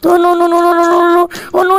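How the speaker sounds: rapid high string of noes; panicked no no. Pretend. Narrator watching Hulk beat everyone, mock panic.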